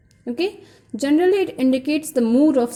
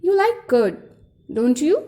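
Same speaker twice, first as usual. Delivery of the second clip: statement turned question by a rising "don't you"; rising question tag; 3 semitones higher than usual. Shown as a demonstration. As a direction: fall on "curd", then lift "don't you". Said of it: "Some more questions".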